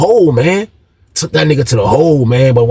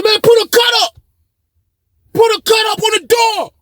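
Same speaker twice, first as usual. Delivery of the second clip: retold shouted order.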